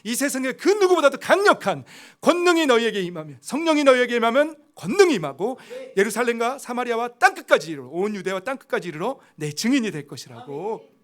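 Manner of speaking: 힘차게 외치는 설교; full-force preaching. Acted. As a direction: preach it out, driving hard to the congregation.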